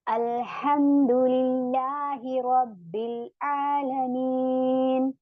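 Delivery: slow, drawn-out Arabic recitation; Quranic recitation. Shown as a demonstration. Teacher recites slowly to show the students. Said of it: "जैसे अब हम पढ़ते हैं ना".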